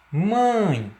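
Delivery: "Mãe" drawn out with a nasal vowel; nasal pronunciation. Shown as a demonstration. Teacher showing students the nasal diphthong, nasal "Mãe". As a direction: let air through the nose on "Mãe". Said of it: "você percebe que há influência nasal".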